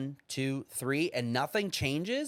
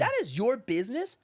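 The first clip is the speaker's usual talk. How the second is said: ordinary talk, nothing marked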